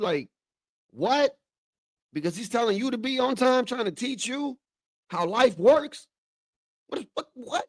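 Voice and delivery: high-pitched, incredulous rant; indignant outburst. Acted. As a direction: scold an ungrateful kid in rising disbelief. Imagined outburst at the ungrateful daughter.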